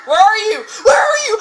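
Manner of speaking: panicked high shout, rising; frightened shouting. Real, not acted. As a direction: call out in panic. Frightened calling in horror game.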